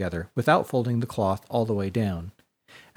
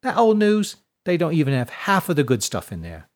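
scoffing Tess; 4 semitones higher than usual. A narrator's reading. Tess scoffs at Ashlyn about the princeps' papers, dismissive. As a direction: wave it off as old news.

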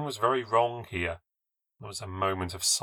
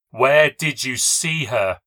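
desperate demand; 2 semitones higher, 6 dB louder, slower than usual. A narrator's reading. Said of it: "She started to shake me".

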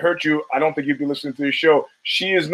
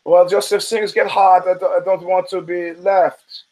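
put-on German accent; 2 semitones higher than usual. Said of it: "in his German accent".

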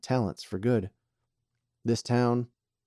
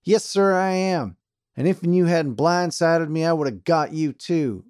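cocky boasting; 8 semitones higher, 6 dB louder than usual. A narrator's reading. Charlie boasting defiantly.

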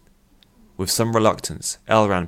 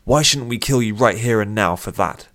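raised, heated threat; threatening; 4 semitones higher, 6 dB louder than usual. A narrator's reading. Captor threatens the pinned bounty hunter, threatening. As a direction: demand it as a threat to a pinned captive.

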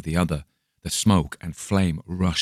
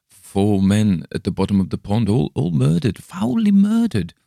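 climbing higher and louder into horror; rising unnatural tone; 5 semitones higher, 5 dB louder than usual. A narrator's reading. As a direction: let the voice rise out of control. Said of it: "in the same unnatural tone".

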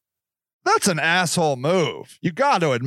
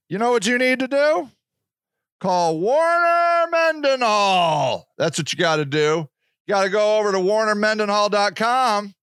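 ad read; 4 semitones higher than usual. Acted. Host pitching sponsor to listeners, upbeat ad read. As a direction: sell the lawyer like a radio spot.

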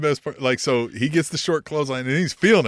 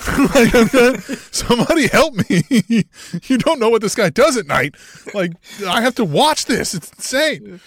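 higher, louder frantic pleading; mock panicked captive; 7 semitones higher, 6 dB louder than usual. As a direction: beg like a hostage. Playing captive Jake begging.

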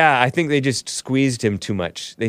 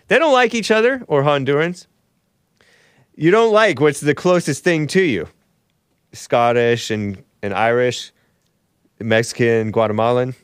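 usual speaking voice

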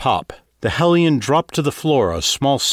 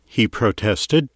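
ordinary delivery, nothing marked

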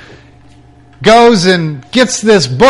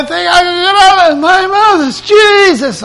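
high, fast, choking on tears; pleading, voice about to break; tearful crying out; 12 semitones higher than usual. Real, not acted. Reliving the night he cried to Jesus, tearful and high.

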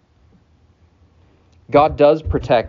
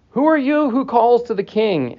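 calling back; 11 semitones higher than usual. Acted. Abner answers from the camp.

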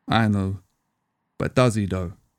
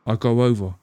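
ordinary delivery, nothing marked

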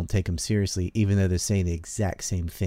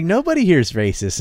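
higher and louder, a hint of a surprised tourist; imitating a Yankee visitor, higher and louder; mock surprised visitor; 9 semitones higher, 6 dB louder than usual. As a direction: lightly mimic an amazed outsider. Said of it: "He's like".

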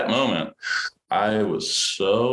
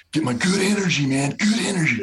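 high, put-on pep-talk voice; hammed-up imitation of crew cheering him on; imitating the crew's good-energy chant; mock set hype preaching; 12 semitones higher than usual. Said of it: "That's all they kept preaching".

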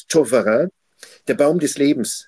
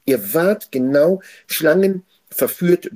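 slow, higher, leaning on Schlangen; slow accusing quote; 4 semitones higher, 3 dB louder, slower than usual. Acted. Joseph telling his brothers they acted like snakes, slow. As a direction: spell out the accusation slowly.

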